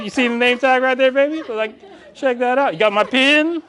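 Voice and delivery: high, show-off mock swagger; bragging realtor. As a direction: flash the name tag like a proud peacock. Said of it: "everybody's like".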